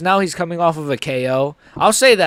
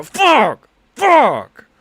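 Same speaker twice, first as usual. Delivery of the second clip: sharp angry yell, much louder and higher; furious shout; 8 semitones higher, 6 dB louder than usual. Real, not acted. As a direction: blurt it out in real rage at the knockout. Fighter loses, streamer reacts.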